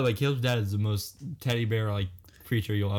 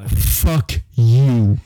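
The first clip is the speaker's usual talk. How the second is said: loud, drawn-out mock retort; indignant outburst; 9 dB louder than usual. Friend answering the boss's ultimatum, loud indignation. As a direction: fire it back loudly at the absent boss.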